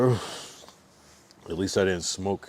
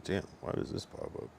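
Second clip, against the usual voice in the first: muttering; 14 dB quieter than usual. Real, not acted. Annoyed aside to screen.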